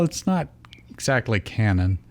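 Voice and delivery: ordinary talk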